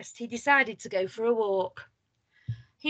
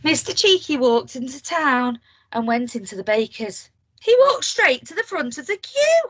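ordinary delivery, nothing marked

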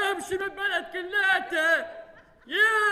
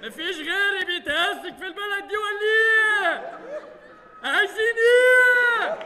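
shouting; 4 semitones higher than usual. Acted. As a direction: yell it at the whole village. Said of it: "وطي صوتك".

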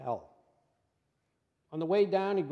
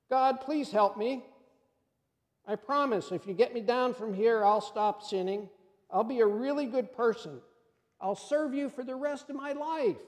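pleading; 6 semitones higher than usual. Acted. Jack begs God for rescue.

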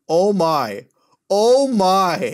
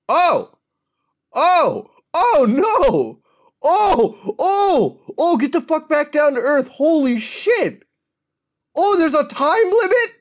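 high, loud, real yelps of alarm; yelping as the game spins out of control; alarmed yelling; 10 semitones higher, 5 dB louder, slower than usual. Real, not acted. Gamer yelling at his phone as his hero floats off, alarmed high yells.

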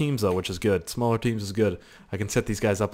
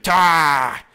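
mock grunt; 5 semitones higher, 6 dB louder than usual. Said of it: "He was like".

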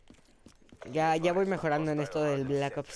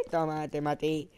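gleeful win; slower than usual. Real, not acted. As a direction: crow with a laugh as the shot lands. Gleeful kill in a shooter.

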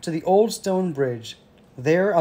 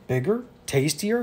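questions rising in mild surprise; a touch of greedy surprise; troll's cry; 3 semitones higher than usual. A narrator's reading. Troll hears of tastier goat, mildly crying out. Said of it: "he cried".

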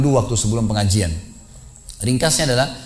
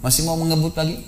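ordinary delivery

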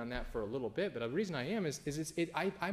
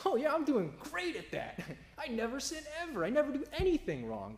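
much higher, louder hammed-up pious voice; mocking smug saint; 14 semitones higher, 5 dB louder than usual. Preacher mocking churchgoers who claim no sin, hammed-up smug voice. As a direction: lampoon someone who claims never to sin.

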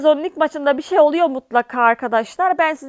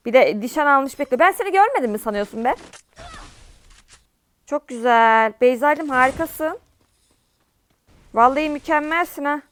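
ordinary talk, nothing marked

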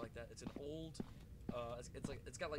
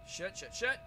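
loud, higher panicked swearing; panicked cursing; 6 semitones higher, 9 dB louder, slower than usual. Real, not acted. Gamer startled by the game, panicked curses. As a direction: blurt it out in fright.

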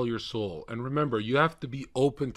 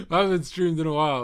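giggly; 8 semitones higher, 10 dB louder, faster than usual. Real, not acted. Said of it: "laughs then repeats".

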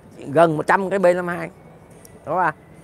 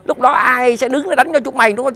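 ordinary delivery

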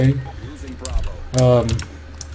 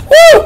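very high, loud whoop; imagined cheer; 10 dB louder than usual. Acted. Answering an imagined teammate's cheer, high whoop. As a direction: whoop right back in falsetto. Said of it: "And I'd be like".

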